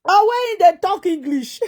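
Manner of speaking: usual speaking voice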